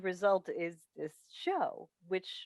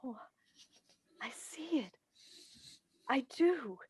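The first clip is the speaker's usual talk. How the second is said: breaking into tears; 7 semitones higher, 4 dB louder than usual. Acted. Mother sees son awake, weeps.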